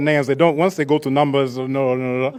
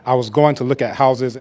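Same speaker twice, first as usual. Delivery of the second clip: usual speaking voice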